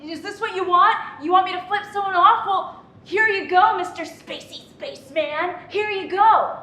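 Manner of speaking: loud mocking taunt; defiant yelling. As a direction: shout it at the alien, taunting. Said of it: "Yeah, I said".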